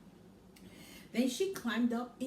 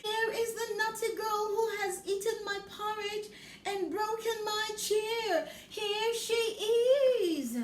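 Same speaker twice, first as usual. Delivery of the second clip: much higher small cub voice; Baby Bear voice; 10 semitones higher, 5 dB louder than usual. Acted. Baby Bear points at Goldilocks, high tiny voice. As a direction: cry it out like a tiny excited cub.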